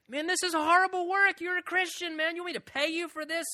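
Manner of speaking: incredulous high-pitched protest at a bad carpenter; indignant customer. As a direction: squeak out the outrage at shoddy work. Acted complaint to carpenter.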